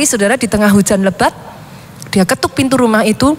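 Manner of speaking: usual speaking voice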